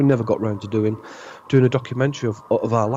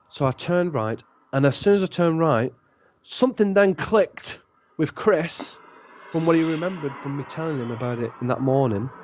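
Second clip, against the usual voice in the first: normal talking voice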